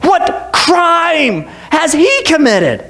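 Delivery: high, slow baffled questioning; Pilate, confused. Acted. Pilate questioning the crowd, really confused. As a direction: draw it out in bewilderment. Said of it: "now Pilate's really confused".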